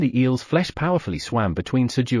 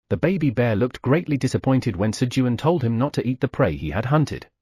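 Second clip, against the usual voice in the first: ordinary delivery, nothing marked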